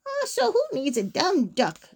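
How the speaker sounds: mild storybook grumbling; grumpy mumble. A narrator's reading. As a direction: brush it off sulkily. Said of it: "Gritch mumbled".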